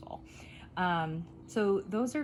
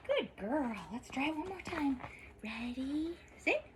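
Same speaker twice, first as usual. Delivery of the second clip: soft high praise; 7 semitones higher, 4 dB quieter than usual. Real, not acted. Praising and cueing puppy.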